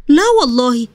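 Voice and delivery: normal talking voice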